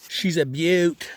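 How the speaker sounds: put-on Australian accent, slow, high and loud; hammed-up Aussie crocodile hunter; bad Aussie accent. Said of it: "terrible, terrible accent".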